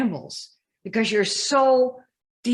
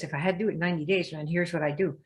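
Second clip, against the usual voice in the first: fast, softer rush of ideas; eager inner planner; 3 semitones lower, 5 dB quieter, faster than usual. Retold or imitated. Inner ideas voice rushing out a plan, fast and soft. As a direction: rattle it off like a sudden plan. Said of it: "the one that comes up with the ideas".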